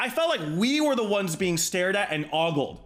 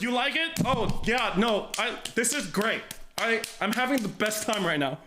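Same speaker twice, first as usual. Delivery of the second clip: nervous; 6 semitones higher than usual. Retold or imitated. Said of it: "I'm just like".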